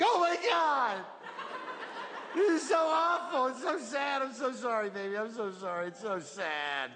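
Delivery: high, wailing caregiver at a deathbed; Jamaican lady wailing. Acted. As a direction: wail over the dying man in a Jamaican lilt. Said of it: "Someone who loves you just like".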